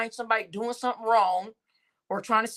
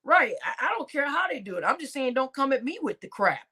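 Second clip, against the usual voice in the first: ordinary talk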